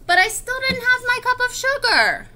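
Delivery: high, insistent storybook whine; exasperated complaint. Acted. Wolf still lacking sugar for granny's cake, exasperated complaint. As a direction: push it up high in mock frustration.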